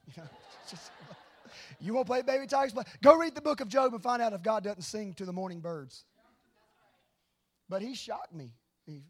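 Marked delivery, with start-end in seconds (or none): 1.8-6.0 loud, high-pitched mock scolding; hammed-up loud challenge, pitched up; mock rebuke. Preacher teasingly rebukes the congregation for laughing, loud mock scolding.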